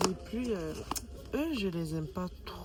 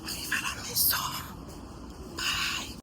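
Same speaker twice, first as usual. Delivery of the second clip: whispering. Real, not acted. Whispered sign-off outdoors.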